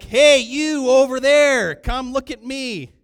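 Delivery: big, high, drawn-out shout; calling across room. Retold or imitated. Customer hails faraway waiter, full-voiced shout. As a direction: bellow for the waiter halfway across a restaurant. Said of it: "literally I'd be like".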